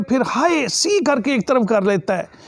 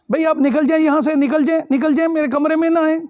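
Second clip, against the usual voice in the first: mildly urgent, higher and hurried plea; 5 semitones higher, faster than usual. Acted. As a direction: quickly urge an intruder to leave, pitched up. Young man urges girl out of room.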